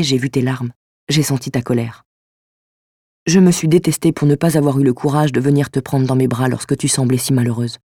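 subdued, lightly voiced letter reading; earnest apology, gently held back; apologetic letter writer. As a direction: read it as a sincere written confession. Reads the mystery writer's letter aloud.

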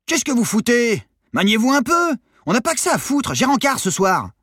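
very high, loud hectoring yell; bawling out. A narrator's reading. Short sailor berating returning teens, yelling. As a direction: tear into the stragglers from shore. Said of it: "engueulait les autres ados".

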